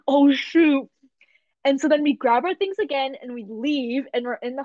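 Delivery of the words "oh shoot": louder, sudden oh shoot; dismayed realisation. Retold or imitated. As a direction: caught out, blurt it. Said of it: "I was like".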